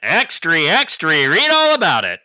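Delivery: loud, high newsboy cry; rapid old-time reporter. Acted. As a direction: shout it like a street paper seller. Podcast intro, newsboy cry.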